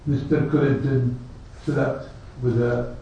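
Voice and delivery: low, hushed aside; offhand gossip. Acted. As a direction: toss it off softly as idle gossip. Voicing people's casual scandal talk.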